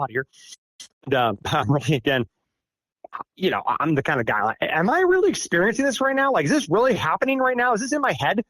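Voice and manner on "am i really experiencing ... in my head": incredulous. Retold or imitated. Doubting his own sanity aloud.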